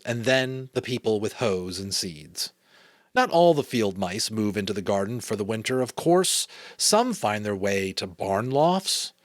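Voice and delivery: measured read-aloud storytelling; story narration. A narrator's reading. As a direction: read a children's novel aloud.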